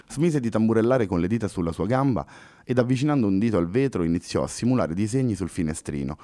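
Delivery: ordinary delivery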